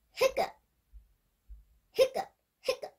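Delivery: high breathy hiccup noises; mock hiccups. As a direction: squeak out the hiccups. Hiccups acted.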